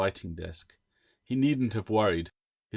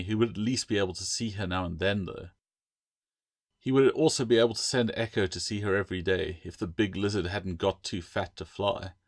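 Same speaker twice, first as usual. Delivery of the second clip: ordinary delivery, nothing marked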